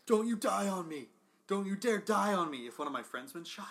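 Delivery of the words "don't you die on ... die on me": loud, high pleading over a fallen friend; dramatic movie plea. Acted. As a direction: beg like a movie hero at a deathbed. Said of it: "that thing that you see in movies".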